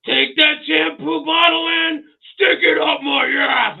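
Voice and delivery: slow, high, loud yell; mock shout. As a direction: belt it out with gusto. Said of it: "I was like".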